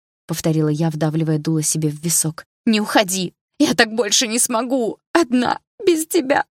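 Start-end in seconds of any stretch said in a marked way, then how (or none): 2.6-6.5 high, rising anguished cry; plead, voice climbing to a wail; desperate pleading. A narrator's reading. Heroine begs, gun to temple. (отчаянная мольба, голос взлетает)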